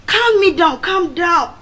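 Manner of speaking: loud, high pleading to calm down; urgent calm down. Retold or imitated. Boys pulling her apart from the fight, loud and high. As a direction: hold her back, urging calm.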